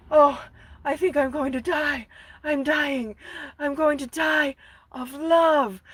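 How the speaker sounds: high, melodramatic lovesick Phantom; hammed-up dying-of-love swoon. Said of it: "the Phantom, he's going".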